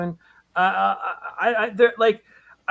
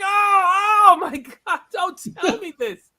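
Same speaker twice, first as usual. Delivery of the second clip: hammed-up anguished cry, much higher and louder at the start; mock anguish; 13 semitones higher, 5 dB louder, slower than usual. Recalling hearing the price of the sold art, mock anguished wail. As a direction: act out a comic wail of despair that fades into a laugh. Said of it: "I was like".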